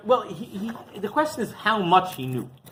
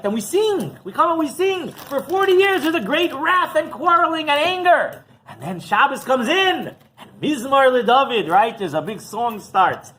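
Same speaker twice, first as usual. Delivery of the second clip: high, loud, rousing preacher's delivery; full-force preaching; 8 semitones higher, 8 dB louder than usual. Acted. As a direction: build it up like a preacher in full flight.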